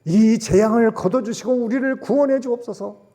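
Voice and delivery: preaching prayer, loud and high; a preacher pleading aloud for deliverance; fervent loud prayer. Acted.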